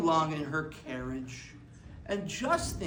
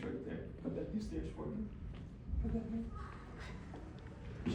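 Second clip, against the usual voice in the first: whispered; 3 semitones higher, 11 dB quieter than usual. Real, not acted. Guiding child off stage quietly.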